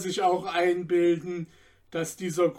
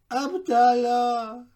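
a lightly voiced old tailor, higher and slow; 6 semitones higher, 3 dB louder, slower than usual. A narrator's reading. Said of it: "erwiderte der Schneider". (leicht gespielter alter Schneider, höher und langsam)

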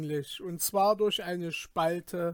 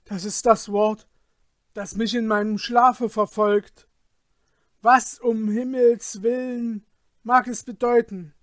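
loud, raised voice of dismay; alarmed crying out; 4 semitones higher, 8 dB louder than usual. A narrator's reading. Man haunted by a word from his sleep, crying out in alarm. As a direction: exclaim it, building higher towards a desperate question. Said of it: "schrie er".

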